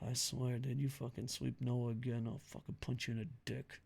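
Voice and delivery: very soft, low aside; low, quiet grumbled warning; mock threat. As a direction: threaten him under your breath, half-joking.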